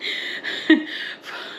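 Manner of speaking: a quiet, breathy giggle. Real, not acted. Counting her freezer meals, a quiet breathy giggle. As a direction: let a silent giggle slip out. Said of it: "I don't know why I'm giggling".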